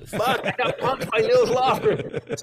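high, drawn-out cry of being duped; comic dismay. Retold or imitated. Fan told there are no tickets, comic dismay. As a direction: wail it like you've been had.

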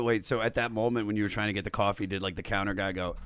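ordinary talk, nothing marked

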